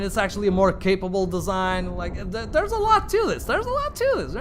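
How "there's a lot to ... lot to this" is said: very high comic falsetto. Acted. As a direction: squeal it way up high, twice over. Emphatic repeat in squeaky high voice.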